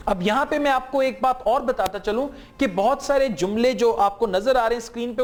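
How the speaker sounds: normal talking voice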